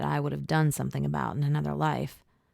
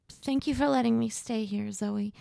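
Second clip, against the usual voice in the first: cracked voice; 7 semitones higher than usual. Acted. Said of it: "Her cracked voice broke my heart".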